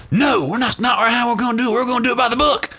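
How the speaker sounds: very high, strained mock preacher; Baptist voice. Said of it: "that's my best Baptist voice".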